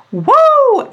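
high, loud whoop of glee; woo cheer. Real, not acted. Coach cheering herself after a bold claim to listeners, loud whoop. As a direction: whoop like you just dropped a bomb.

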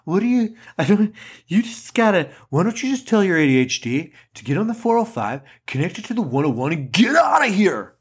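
put-on Californians sketch accent. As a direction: play a freeway-obsessed SoCal character from SNL. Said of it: "if you guys watch Saturday Night Live".

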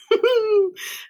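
a quick high burst of laughter; bright laugh. Real, not acted. Host laughing at her own podcast name, real laugh. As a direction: laugh at your own joke.

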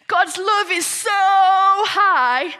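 soaring high, loud, drawn-out 'so high'; high and stretched. Shown as a demonstration. Teaching children how high God's love is, high and stretched.

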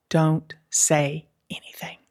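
hushed whisper to show the silence. Shown as a demonstration. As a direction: drop to a whisper, like a secret tip. Teaching the power of pause.

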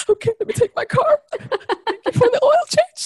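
very high, loud, laughing and crying at once; laughing through tears. Retold or imitated. Overwhelmed by the gift, she thanks God laughing and crying. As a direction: squeal it out, half laughing half sobbing. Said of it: "I was like".

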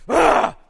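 loud, high explosive yell; sudden fighter's roar. Retold or imitated. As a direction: burst out in a wild cry. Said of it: "A noise that that man has ne".

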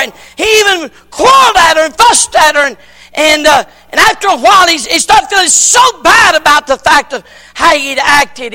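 loud, high revival preaching; preaching at full force. Acted. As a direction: preach it out to the back pews. Preacher tells story at full force.

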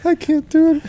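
very high squeaky gnome. Pretend. Host attempts the gnome voice when asked, high squeak. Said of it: "Do the gnome voice".

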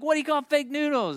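high-pitched, eager repeat of the riddle; insistent joke setup. Retold or imitated. Kid repeating the joke to puzzled mother, insistent and high.

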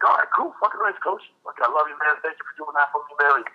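high, gushing thanks to the coach; excited thanks. Retold or imitated. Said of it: "So I was like".

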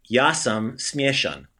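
Ja sam smieshan slowly, syllable by syllable; slow careful Croatian. Shown as a demonstration. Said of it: "the male way of saying it".

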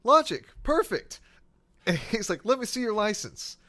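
imitating a partner's fake enthusiasm; mock sarcastic cheer. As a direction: partner retelling the officer's sarcastic reply, squeaky and loud. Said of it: "so he goes".

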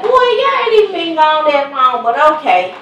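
high, loud mock scolding; teasing scold. Mother to son on the phone, high teasing scold. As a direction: brush him off with a laugh in the voice. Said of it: "I said".

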